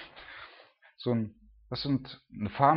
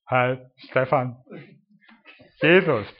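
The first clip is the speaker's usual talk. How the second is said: slow, drawn-out greeting with a much louder punch on Jesus; mock humble introduction; 7 dB louder, slower than usual. Imitating himself greeting people, slow with a loud Jesus. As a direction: introduce yourself slowly, then land Jesus loud. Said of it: "ich komm einfach an und sag".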